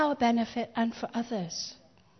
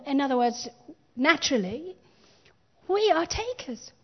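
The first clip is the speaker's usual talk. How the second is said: ordinary delivery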